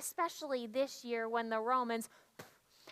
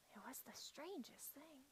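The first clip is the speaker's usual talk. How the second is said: awed whisper; 20 dB quieter than usual. Real, not acted. Awed memory of the rooster.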